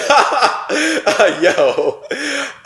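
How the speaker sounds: high, loud burst of laughter; big real laugh; emotional laugh. Real, not acted. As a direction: laugh out, overwhelmed. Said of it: "That was me getting emotional".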